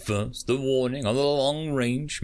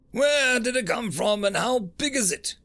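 gasping; 12 semitones higher than usual. A narrator's reading. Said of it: "gasped Carmack".

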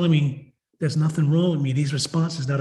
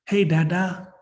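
a hint of a little child, slightly raised; imitated child's hello, rising at the end; toddler's greeting; 3 semitones higher, slower than usual. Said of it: "this little human being that's nineteen months old".

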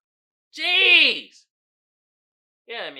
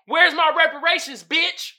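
loud, high angry yell; angry demand; 8 semitones higher, 10 dB louder than usual. Retold or imitated. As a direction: demand it furiously. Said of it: "And it reads".

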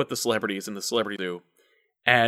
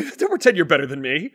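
normal talking voice

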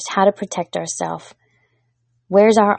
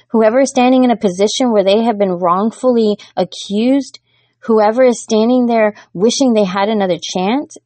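building preaching appeal; 3 semitones higher than usual. Acted. Preacher pleading with the congregation, building in fervor. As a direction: lift the congregation with each whatever. Sermon rising to a fervent appeal.

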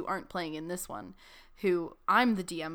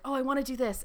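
higher, louder imitated eagerness; eager player; 6 semitones higher, 6 dB louder, faster than usual. Player proposing a plan to the DM, imitated eagerness. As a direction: pitch it up like a keen player.

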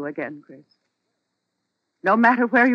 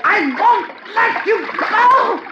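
terrified pleading; 7 semitones higher, slower than usual. Acted. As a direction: beg for your life, screaming. Wife being drowned, pleading.

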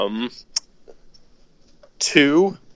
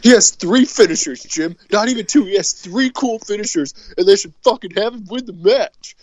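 higher, loud complaining wrestler; whiny Steve impression; 9 semitones higher, 3 dB louder than usual. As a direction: whine it like a sore loser, pitched up. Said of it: "but that's Steve".

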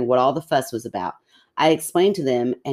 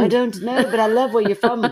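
high, put-on Scottish lilt, badly done; a hammed-up, not-quite Scottish accent; 10 semitones higher, 3 dB louder than usual. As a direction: play a jokey Scottish accent that misses. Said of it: "That was not a good Scottish accent".

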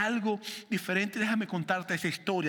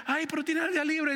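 a higher, lightly voiced wife coaxing; 10 semitones higher than usual. Preacher imitates his wife urging him on his day off, higher. Mi esposa me dice.

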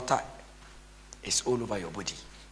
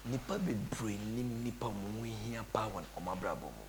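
quiet quote; 10 dB quieter than usual. Retold or imitated. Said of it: "when he said".

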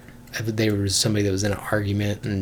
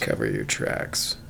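deep breathy whisper; low whisper; 10 dB quieter, slower than usual. Pretend. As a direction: whisper the title low and slow. Low whispered echo of title.

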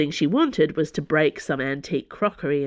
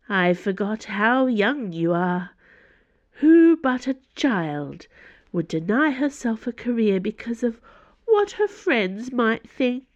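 slow, higher-pitched lofty scorn; condescending Cardinal; 5 semitones higher than usual. Acted. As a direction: belittle her as a Cardinal would a child. Cardinal belittles Dana.

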